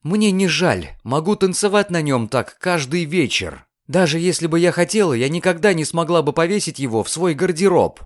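high-pitched, heated defiance; indignant girl, mildly heated; angry declaration. A narrator's reading. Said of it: "сердито объявила она".

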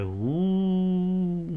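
steady imitated drone; long droning hum. A narrator's reading. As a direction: imitate a roar in the ears with one held note. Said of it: "І у вуха ще дужче".

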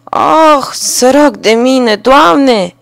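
loud, high, slow wail to God; lamenting carter. A narrator's reading. Carter mourning his spilled wine, loud wail. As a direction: cry out his loss, drawn out. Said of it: "s-a jeluit iar".

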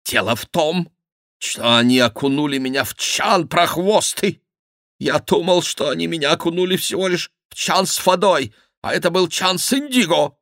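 high-pitched aggrieved explanation; indignant German nobleman. Acted. Schomberg tells the king of the indigo vat, high and aggrieved.

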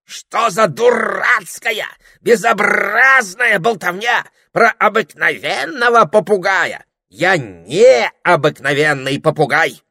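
loud, high, slow-drawn parrot tirade; angry rant. A narrator's reading. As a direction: rage with every word stretched out. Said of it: "разозлился попугай".

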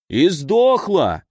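slow, drawn-out announcement; loud announcement. Acted. The kennelman declares the dog dead, announcing slowly. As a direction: announce it slowly to the whole hall. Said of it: "объявил громко".

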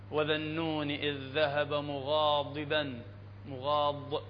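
slow, low recitation with careful ض in مغاضبا; precise Dad. Shown as a demonstration. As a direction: articulate the ض of مغاضبا exactly and deliberately. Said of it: "نقرأه بدقة".

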